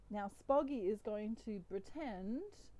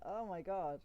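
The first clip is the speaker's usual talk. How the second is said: ordinary delivery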